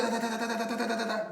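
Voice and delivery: high rapid da-da-da like a turret firing; imitating a machine gun; mock gunfire. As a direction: spit out gunfire syllables.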